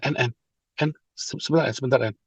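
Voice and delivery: put-on hushed, low-key call to a child; quiet fatherly call; gently calling child. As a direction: softly get the child's attention without scolding. Father calmly calls misbehaving child over.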